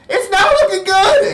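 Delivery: high-pitched, loud acted yell; screaming girl. Running girl warning the group, screaming. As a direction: call out in hammed-up panic. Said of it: "and yelling and going".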